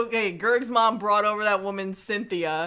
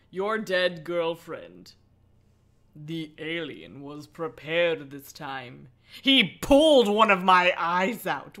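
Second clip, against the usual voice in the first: slow, dark reading voice that swells louder; eerie read-aloud for the stream; dramatic story narration; 3 semitones lower, 4 dB louder, slower than usual. A narrator's reading. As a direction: read it like a horror tale. Reads the horror book aloud.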